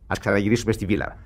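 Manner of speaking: usual speaking voice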